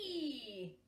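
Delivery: joyful skateboard cry; gleeful whee. Acted. Squirrels racing on a skateboard, gleeful. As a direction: ride the skateboard.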